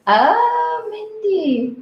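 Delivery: high, bright cry of recognition; light up at a friend's name; delighted surprise. Real, not acted.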